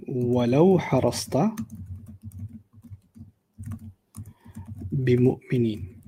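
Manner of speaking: measured Quranic chant; Quran recitation. Acted.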